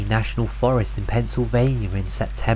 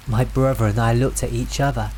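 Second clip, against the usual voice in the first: ordinary delivery, nothing marked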